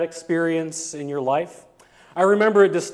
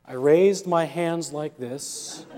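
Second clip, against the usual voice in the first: ordinary talk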